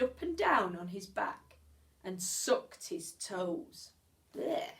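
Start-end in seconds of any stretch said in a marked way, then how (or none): none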